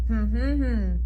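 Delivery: closed-lip hum, one beat per syllable; hummed syllables. Shown as a demonstration. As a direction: hum out each syllable of banana with lips shut. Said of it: "we did banana".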